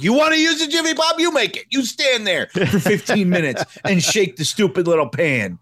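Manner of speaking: high, fast mocking parent; exasperated parent. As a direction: scold like an annoyed mom. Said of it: "it'd be like".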